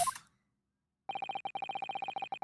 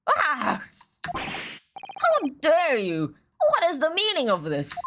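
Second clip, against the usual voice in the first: outraged judge; 7 semitones higher, slower than usual. Acted.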